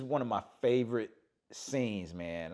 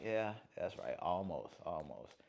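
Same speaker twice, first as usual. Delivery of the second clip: quiet musing dad; 6 semitones lower, 11 dB quieter than usual. Retold or imitated. Dad voiced in retold scene.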